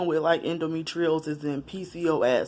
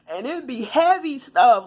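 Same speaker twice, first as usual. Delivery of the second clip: higher and laughing; a real laugh carrying the line; 8 semitones higher, 5 dB louder than usual. Real, not acted. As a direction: laugh while getting the words out. Laughing at strangers confiding in her.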